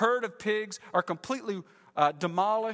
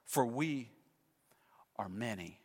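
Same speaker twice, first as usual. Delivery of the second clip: low, much softer demon whisper-like; ominous many-voiced demon, lower and softer; 9 semitones lower, 7 dB quieter than usual. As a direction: drop to a dark, low murmur. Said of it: "he makes it very clear with what he says next".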